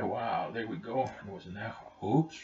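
ordinary delivery

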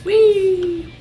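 high gleeful whee; mock delighted cry; cheerful squeal; playful whee. Fun jump.

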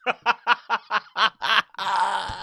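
long real chuckle; amused laugh. Real, not acted. Host imagining the beating on video, amused laugh. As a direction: laugh in a steady run of ha-has. Pictures Akademiks getting punched.

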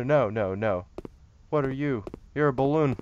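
usual speaking voice

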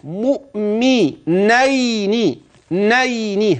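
مؤمنين slow with a clear نين ending; slow recited مؤمنين; careful ending. Shown as a demonstration. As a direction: finish مؤمنين on a crisp نين. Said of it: "هكذا خلي بالك".

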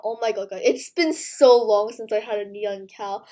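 usual speaking voice

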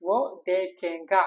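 വോ ദേഖേങ്ക said slowly and carefully, syllable by syllable; slow model phrase. Shown as a demonstration. Teacher showing students the Hindi for he will see, slow. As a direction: lay out വോ ദേഖേങ്ക slowly for the class. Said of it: "നമ്മൾ മറ്റൊരാളെ കുറിച്ച് അവൻ കാണും".

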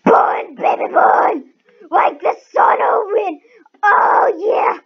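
loud high triumphant chant; cheering. Real, not acted. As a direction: cheer it out big.

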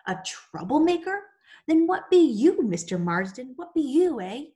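loud, high, furious retort; indignant outburst. A narrator's reading. Mill girl flaring up at the overseer, shouting. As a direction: blurt it back at him, outraged. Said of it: "She leapt to her feet. She couldn't seem to stop herself".

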